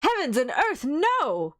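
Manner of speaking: high, loud furious refusal; storm of passion. Acted. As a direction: burst out in outraged protest. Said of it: "cried Arthur in a storm of passion".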